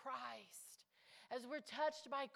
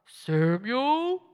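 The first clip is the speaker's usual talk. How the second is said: calling voice; 21 dB louder than usual. Acted. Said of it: "the Lord would come".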